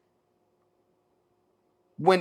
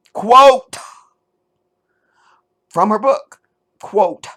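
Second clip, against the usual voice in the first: a sudden, much higher and louder "Quote"; loud call; 7 semitones higher, 8 dB louder, slower than usual. Real, not acted. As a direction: bellow the word to grab attention. Loudly announcing a quote.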